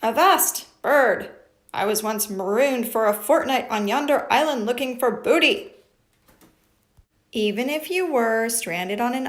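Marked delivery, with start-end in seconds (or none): 0.0-5.7 mock pirate scolding. Pirate puppet scolding the parrot, loud mock bluster. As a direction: puff up and scold the bird.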